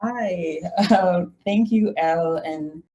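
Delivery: usual speaking voice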